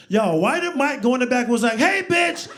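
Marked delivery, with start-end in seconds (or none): 1.8-2.5 very high, loud mock shout; hammed-up high-pitched yell; calling out insult. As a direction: holler it toward the back. Imagined Mike passing on the shouted message.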